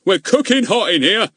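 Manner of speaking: high, loud roar. Acted. Goon roaring at Ern to hurry. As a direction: bellow it at the boy.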